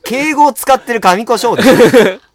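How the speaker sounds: loud high teasing; laughing teasing. Real, not acted. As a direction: tease him, loud and laughing. お坊さん役に笑いながらツッコむ. (笑いながら高く大声でツッコむ)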